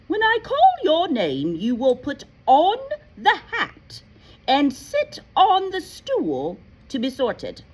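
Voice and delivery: high, loud, commanding schoolmistress; stern McGonagall. Acted. A stern teacher addresses the waiting new students, loudly. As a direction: call the first-years forward with strict authority. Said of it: "she said".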